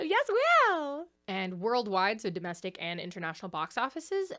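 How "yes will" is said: high, loud shout of support; excited cheer. Real, not acted. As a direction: burst into a cheer for the writer. Cheering the writer a second time.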